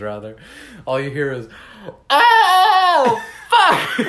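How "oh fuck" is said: high, loud drawn-out wail breaking into a yodel; top-of-lungs yell. Retold or imitated. Imitating a stranger in a bathroom stall, top-of-lungs yell. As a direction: bellow it out at full volume, yodel-like. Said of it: "at top of their lungs".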